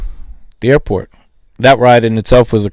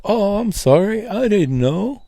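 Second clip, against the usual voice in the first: mock innocent apology; 9 semitones higher, 4 dB quieter, slower than usual. Feigning innocence to customs agent.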